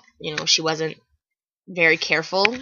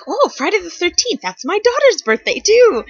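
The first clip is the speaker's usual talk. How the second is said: much higher, louder delighted woman; excited lady; 13 semitones higher, 5 dB louder than usual. Retold or imitated. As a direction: squeal it like a thrilled stranger. Lady behind voiced excitedly.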